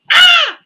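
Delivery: sudden high, loud scream; startled scream. Retold or imitated. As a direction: scream in shock at a sneak attack. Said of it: "one hundred percent screamed out".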